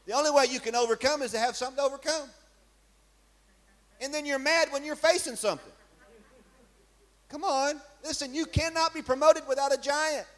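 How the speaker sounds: high, driving preacher's voice; rising pulpit fervor, pitched well above his talk; fiery preaching. Acted. Preaching rises to full force.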